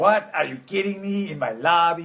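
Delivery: a hint of the hotel vampire's outrage; quoting a cartoon vampire. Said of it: "you know, Hotel Transylvania".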